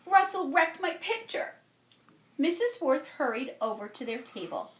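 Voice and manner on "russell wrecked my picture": high, louder upset complaint; girl complaining to the teacher about her ruined picture; cried out. A narrator's reading. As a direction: tell on him, loud and hurt. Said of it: "Jamaica cried".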